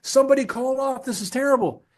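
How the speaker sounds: high, loud whiny panic voice; hammed-up high loud distress; mock panicked leader. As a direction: squeal it like a leader in a tizzy. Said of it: "they're like".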